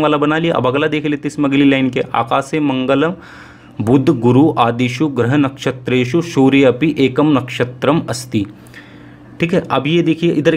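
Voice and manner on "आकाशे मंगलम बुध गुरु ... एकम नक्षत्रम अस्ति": slow, deliberate Sanskrit recitation; slow reading aloud. Shown as a demonstration. Teacher slowly reading a Sanskrit passage to students, slow and deliberate. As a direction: read each word slowly so students follow. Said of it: "मैं धीरे धीरे पढ़ूंगा इस गद्यांश को".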